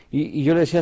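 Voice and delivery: usual speaking voice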